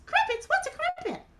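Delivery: high, quick puppet voice; squeaky little character butting in; Mimi. Acted. Mimi asks the storyteller about the party, high puppet voice.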